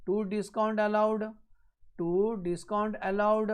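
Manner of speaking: very slow, drawn-out words; slow dictation. Real, not acted. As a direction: dictate it slowly for note-takers. Teacher dictates the entry while writing. (धीरे-धीरे लिखवाते हुए)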